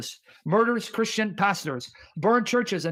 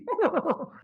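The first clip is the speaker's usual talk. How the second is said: short laugh; 7 semitones higher than usual. Real, not acted. Laughs after mock cheer.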